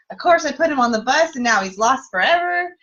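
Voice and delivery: hamming up her own panic; mock anxious wail. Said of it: "I start to get all emotional".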